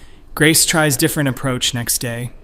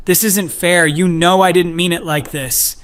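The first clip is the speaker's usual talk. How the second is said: higher, louder distraught protest; raised, near-hysterical voice; 6 semitones higher, 7 dB louder than usual. A narrator's reading. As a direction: cry out that it's unfair. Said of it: "Grace becomes absolutely hysterical".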